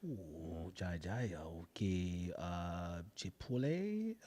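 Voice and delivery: very soft, low fake Spanish in a drifting accent; hushed gibberish in a botched foreign accent; botched wandering accent. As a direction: fumble for mock-Spanish words in a wobbly accent. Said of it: "trying to come back into, like, Chipotle".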